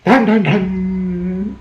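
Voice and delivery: loud, high sung dramatic sting; mock suspense music, long held last note; horror-movie sting. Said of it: "like a horror movie".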